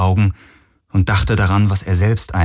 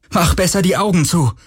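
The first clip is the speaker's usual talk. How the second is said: urgent warning; 10 semitones higher than usual. A narrator's reading.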